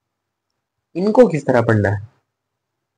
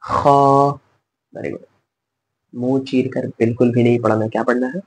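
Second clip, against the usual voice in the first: usual speaking voice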